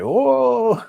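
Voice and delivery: high, loud gasped oh; mock shocked oh. Siblings reacting to the slap, shocked oh. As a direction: act out the onlookers' shock. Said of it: "they was like".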